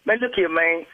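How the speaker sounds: ordinary talk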